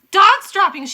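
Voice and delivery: loud, high disgusted yell. Acted. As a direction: bellow the alarm. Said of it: "she yelled".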